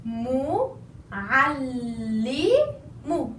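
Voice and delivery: Mu'allimu drawn out syllable by syllable; very slow syllables. Shown as a demonstration. Teacher spells the word out for students, very slow. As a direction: stretch Mu'allimu slowly with double l. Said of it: "I said mu'allimu".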